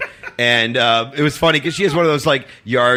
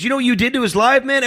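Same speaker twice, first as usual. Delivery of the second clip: fast, high-pitched scolding imitation; indignant stranger; 12 semitones higher, faster than usual. Stranger blames him for friend's life, imitated fast high scolding. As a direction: rush the accusation in a raised voice. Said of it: "He goes".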